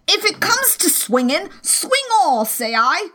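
high, strained angry cry; the captain cries out in a rage; old captain crying out, high and furious. Acted. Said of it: "and again".

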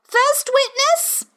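high, slow herald call; high rabbit calling out. A narrator's reading. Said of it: "called out".

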